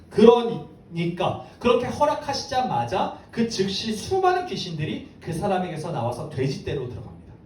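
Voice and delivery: normal talking voice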